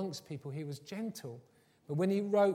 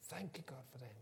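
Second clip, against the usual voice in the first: hushed, soft thanksgiving; quiet prayer; 4 semitones lower, 12 dB quieter than usual. Acted. Believer thanking God for each other, hushed prayer. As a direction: pray it very softly.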